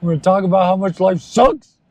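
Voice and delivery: high, mock-cheery moping voice; sing-song gloom like a sad party host; lightly voiced gloomy cheer from UHF; quoting the movie. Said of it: "That's also from U H F".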